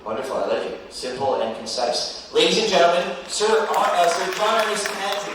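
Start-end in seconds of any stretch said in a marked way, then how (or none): 2.3-5.3 big, loud pageant host call; announcer voice. Acted. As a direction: announce him to the crowd. Host announcing winner loudly.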